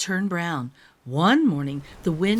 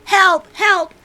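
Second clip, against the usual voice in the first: much louder, high panicked cry; cried for help; 12 semitones higher, 6 dB louder than usual. Acted. Cub cries out as everyone steals leaves, high and loud. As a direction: shout for help in alarm. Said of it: "cried Fletcher".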